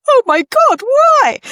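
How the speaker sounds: high, loud disbelieving squeal; retelling her own amazed outburst; incredulous why. Retold or imitated. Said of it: "my question was something along the lines of".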